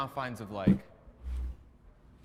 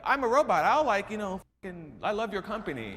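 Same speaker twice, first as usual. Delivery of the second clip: higher, louder fake-keen employee voice; mock robotic worker; 7 semitones higher, 5 dB louder than usual. Mocking a worker who fakes love for his company, robotic and keen. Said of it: "lie to these f-ing people to be like".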